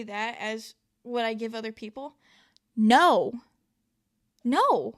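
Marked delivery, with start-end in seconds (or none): 2.8-4.9 loud, firm refusal; emphatic no. Real, not acted. Refusing to carry negativity, loud and firm. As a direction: slam the door on it.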